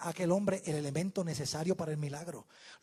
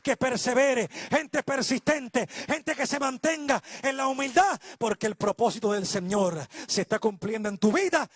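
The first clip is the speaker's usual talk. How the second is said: insistent, raised exhortation in parallel phrases; full-force preaching; 5 semitones higher, 5 dB louder than usual. Acted. Preacher telling the church God seeks persevering people, forceful preaching. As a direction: press each phrase on the listeners.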